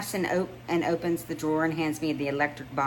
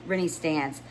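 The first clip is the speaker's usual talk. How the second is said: usual speaking voice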